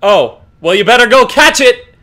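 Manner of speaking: loud, hammed-up punchline; prank punchline. Delivering the prank punchline to her brother, loud and gleeful. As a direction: deliver the old joke loudly, grinning.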